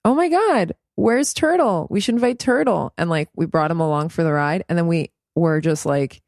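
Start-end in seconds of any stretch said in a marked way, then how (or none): 0.0-2.9 high, playful excited call. Retold or imitated. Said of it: "So yesterday I was like".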